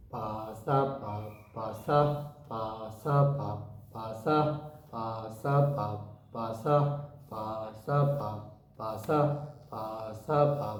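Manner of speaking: "পাসা পা, পাসা" chanted low and slow in a looping beat; rhythmic group drill. Shown as a demonstration. Teacher leads students to say it together, "পাসা পা, পাসা" chanted low in rhythm. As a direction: stretch the first পা, gap after "পাসা পা", then quick "পাসা". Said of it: "বলেন, একসাথে বলবো".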